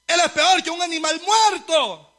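retold taunting cry; imitated yelled insult. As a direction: hurl it out like a heckler. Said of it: "así le grita".

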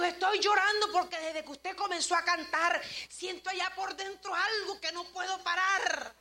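imitated tearful man. Stranger at the funeral explains his crying, mock tearful. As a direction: imitate someone overcome with tears. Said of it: "por qué está llorando".

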